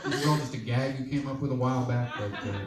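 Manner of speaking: ordinary talk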